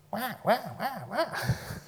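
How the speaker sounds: high mock baby wailing; imitated baby whine. Preacher likening the restless soul to a baby, mock wailing.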